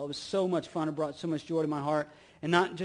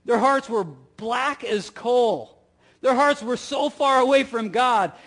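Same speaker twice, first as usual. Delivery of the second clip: loud, rising preacher's build; the pastor preaches Peter's point to the congregation, loud and rising; full-force preaching; 6 semitones higher, 6 dB louder than usual. Acted. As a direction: drive it home from the pulpit.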